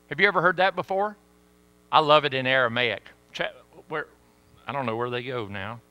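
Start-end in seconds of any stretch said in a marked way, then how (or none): none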